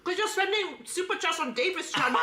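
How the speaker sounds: high, lively mock American accent; put-on American accent. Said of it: "I will try to imitate an American accent".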